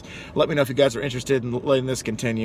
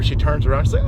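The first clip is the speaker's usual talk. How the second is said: ordinary delivery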